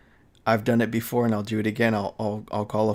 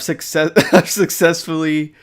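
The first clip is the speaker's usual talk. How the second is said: laughing; 5 semitones higher, 9 dB louder than usual. Real, not acted. Laughs while confessing.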